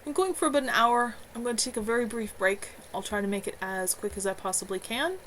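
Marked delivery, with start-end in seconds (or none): none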